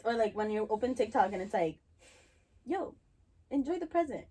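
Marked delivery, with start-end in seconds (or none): 2.6-4.2 preachy influencer voice. Retold or imitated. Voicing preachy TikTok post.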